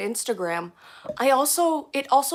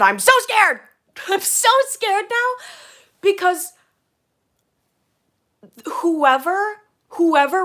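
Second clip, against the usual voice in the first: scared; 10 semitones higher, 6 dB louder, slower than usual. Real, not acted. Streamer panicking about banned account.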